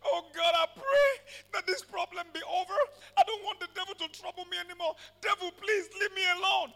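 mock a timid believer begging and crying; mock weeping prayer. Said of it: "be weeping and shedding tears and say".

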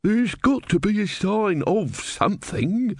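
put-on high, slow, insistent old man; elderly man. A narrator's reading. Elderly man arguing with the wooden-legged man, insistent old voice. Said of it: "said the elderly man".

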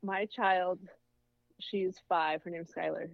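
ordinary talk

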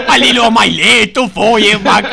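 Cacciari impression. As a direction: rant like an outraged TV philosopher. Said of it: "l'imitazione di Cacciari".

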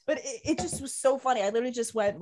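normal talking voice

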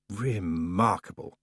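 slow, soft goddess voice; Summer Lady voice. Acted. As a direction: draw it out coolly.

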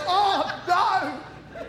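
high, loud laughing oh no; a caught-out groan of realisation; mock dismay. Realising God wants him back in the shop, laughing dismay.

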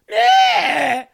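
sudden high loud exasperated yell; frustrated yell. Real, not acted. Host fed up with complaining viewers, exasperated loud yell. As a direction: let out a fed-up shout.

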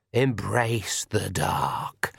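very slow, eerie accusing voice; shadow voice. Acted. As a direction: drag out the accusation from the dark. Shadow voice tempts.

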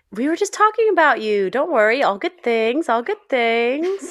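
high, bright cheerful snowman; chirpy Olaf impression. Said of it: "that tone reminded me of Olaf".

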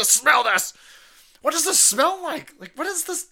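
high-pitched, loud retold yelling; excited shouting. Host retells making someone smell it, excited high shout.

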